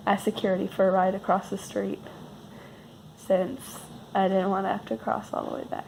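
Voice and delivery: ordinary delivery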